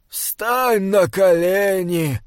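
put-on high, slow command of an old cleric; insistent old abbot. A narrator's reading. Old bishop orders the archivist to pray, high insistent voice.

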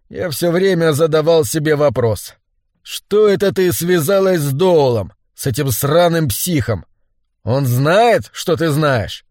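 ordinary delivery, nothing marked

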